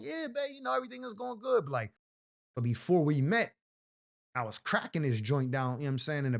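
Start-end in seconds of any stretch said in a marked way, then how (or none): 0.0-1.6 put-on high, soft sweet girlfriend voice. Girlfriend sweetly reassuring her man, high and soft. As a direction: coo it softly, up high like the girlfriend. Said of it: "She can be like".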